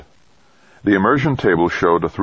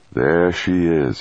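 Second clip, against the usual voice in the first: slow, relaxed pilot voice; unhurried and content; calm male pilot voice; 2 semitones higher, slower than usual. A narrator's reading. Rodriguez sighing happily, spots mountain.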